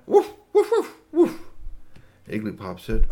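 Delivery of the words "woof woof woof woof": high, loud imitation woofs; dog bark. Maxie the dog talks to Igglypop, imitated high barks. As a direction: yap like an excited dog. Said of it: "Maxie sat down and looked at Igglypop and went".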